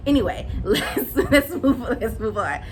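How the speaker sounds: laughing through it, moving on; chuckling as she changes topic; giggling while trying to move on. Real, not acted. Laughing off her rant.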